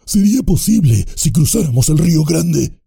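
shrill orc objection; another orc. A narrator's reading. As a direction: another goblin arguing. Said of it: "dijo otra voz".

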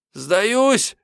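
high-pitched, quavering surrender cry; thin trembling cry. A narrator's reading. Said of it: "крикнул он неожиданно тонким дрожащим голосом". (крик тонким дрожащим голосом)

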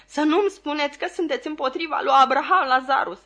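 high, loud, panicked protest; hysterical cry. A narrator's reading. Client fearing the lawyer is against his brother, hysterical. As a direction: wail it out in alarm. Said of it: "răgni individul isterizat".

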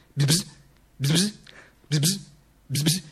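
soft buzzing like a fly. Acted. Dead Duce mimics the flies in the sun. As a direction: buzz lazily, fading away.

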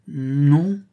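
a hesitant, half-hearted no; unconvinced. Acted. Huck answering Tom, unconvinced and reluctant. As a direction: say no without believing it. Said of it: "d'un ton peu convaincu".

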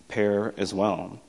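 usual speaking voice

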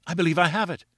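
a sudden cry of joy at the discovery. A narrator's reading. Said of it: "a sudden cry of joy".

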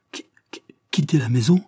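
stammering, appalled question; dismayed stammer. A narrator's reading. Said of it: "bégaya Tiffel en se retournant, consterné".

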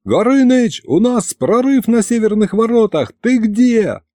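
high-pitched, urgent report; panicked call. Acted. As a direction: demand help in a panic. Panicked report to dragon ally.

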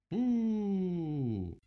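long loud high boo, gliding down. A narrator's reading. Rabbit ends his list of charges, booing. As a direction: draw out a big jeer.

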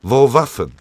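loud, barked demand for weapons; threatening soldier's loud interrogation; broken-German demand. A narrator's reading. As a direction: bark it curtly in broken German. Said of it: "radebrechte er".

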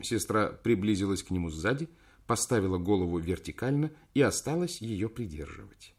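ordinary delivery, nothing marked

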